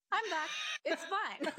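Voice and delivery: high-pitched, put-on "all fine" voice; mock composure after crying. Dancer acting out recovering from a quarantine cry, mock composure. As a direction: pitch it way up, pretending to have recovered.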